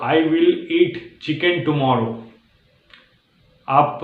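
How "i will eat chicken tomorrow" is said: slow, careful example sentence; slow demo. Shown as a demonstration. Teacher reading the stuck sentence slowly to learners. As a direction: lay out each word slowly. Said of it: "आप बोल रहे हो".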